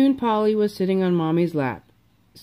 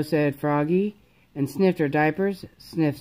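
plain reading voice

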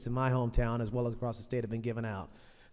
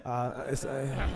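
ordinary talk, nothing marked

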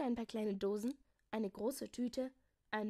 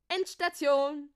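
loud, higher, drawn-out announcement; calling out; 5 semitones higher, 12 dB louder, slower than usual. A narrator's reading. Jakobus announces the end of the ride, calling out. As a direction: announce it like a conductor at the last stop. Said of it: "rief er".

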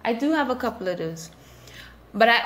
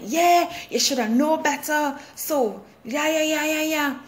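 a mock know-it-all, raised pitch; all-knowing authority; 6 semitones higher than usual. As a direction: lord it over her, smugly dismissive. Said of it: "with this all-knowing authority".

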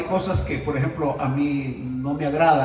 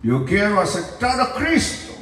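put-on slow, slurred drunk plea; lightly voiced drunk asking; drunk's request; 5 semitones higher than usual. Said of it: "¿Y qué quieres?".

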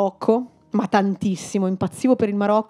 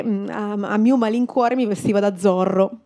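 usual speaking voice

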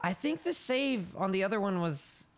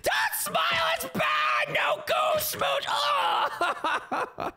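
terrified scream; much slower than usual. Real, not acted. Screaming at monster.

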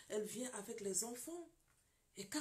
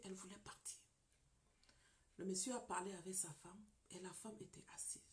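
hushed narration; 4 semitones lower, 9 dB quieter than usual. A narrator's reading. Narrating tense moment.

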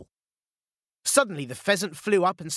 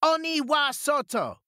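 much higher battle cry; shout; 11 semitones higher than usual. A narrator's reading. Peach Boy shouting to drive ogres out. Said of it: "the Peach Boy shouted".

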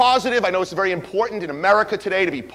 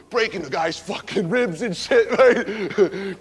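ordinary delivery

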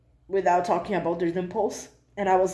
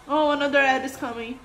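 ordinary talk, nothing marked

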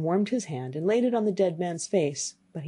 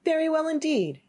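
high, breezy storybook youth; cheerful answer; 10 semitones higher than usual. Acted. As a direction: answer brightly, pitched well up.

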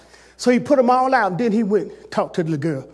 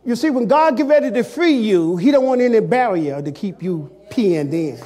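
ordinary talk

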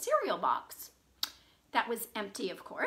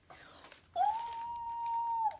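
high, soft drawn-out ooh; excited cry; 7 dB quieter than usual. A narrator's reading. Farm animals excited to see the new one, high cooing cry. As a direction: coo it high like awed animals. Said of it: "cried all the farm animals".

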